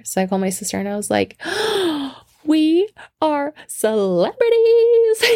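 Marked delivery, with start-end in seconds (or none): 1.5-2.1 big high-pitched mock gasp; excited gasp. Retold call to sister. 2.4-5.2 high, drawn-out squeal into laughter; gleeful announcement. Retold or imitated. Thrilled news to sister, retold.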